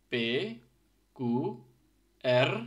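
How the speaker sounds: পে, কু, এর said crisply, এর rolled and loud; teacher modelling the letters; Finnish letter names. Shown as a demonstration. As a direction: sound out each letter. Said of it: "So just listen, carefully".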